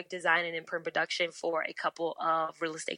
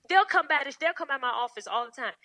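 normal talking voice

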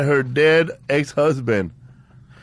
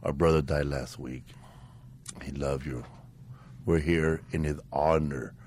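solemn; 9 semitones lower, 9 dB quieter than usual. Retold or imitated. Quoting grieving family.

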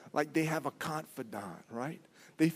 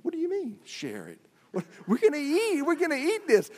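indignant disbelief; 11 semitones higher, faster than usual. Retold or imitated. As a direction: act out being shocked at the idea of sharing. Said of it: "I said".

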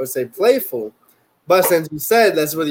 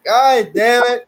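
mock frustration; 9 semitones higher, 5 dB louder, slower than usual. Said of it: "I'm like".

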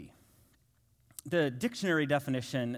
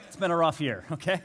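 laughing through the words at his own joke; 3 dB louder than usual. Real, not acted. Pastor laughing to congregation about edited photo, laughing. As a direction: chuckle it out to the congregation.

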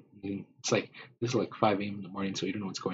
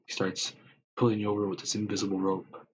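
ordinary talk

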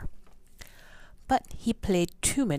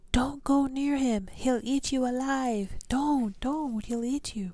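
high pleading warning; people yelling; 10 semitones higher than usual. Retold or imitated. Townspeople warning the boy and father, a high pleading yell. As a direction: beg them not to go, pitched way up. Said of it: "People yelled".

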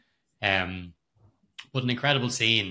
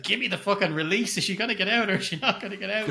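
high, fast, laughing plea; desperate viewer; 8 semitones higher, faster than usual. Retold or imitated. Viewer begging the film for release, high and fast. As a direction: beg for the release, rushing and laughing. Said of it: "I'm just like".

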